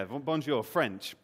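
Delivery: ordinary talk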